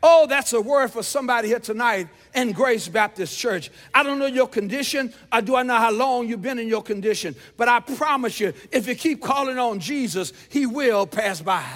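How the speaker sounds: loud, emphatic exhortation; full-force preaching. Real, not acted. Preacher promising the congregation Jesus will pass by, loud and fervent.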